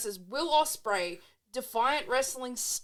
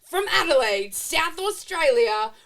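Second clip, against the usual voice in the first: booming ring announcer, building louder and higher; 9 semitones higher, 10 dB louder, slower than usual. Pretend. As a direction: announce him like a main event. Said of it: "put on your best ring announcer voice".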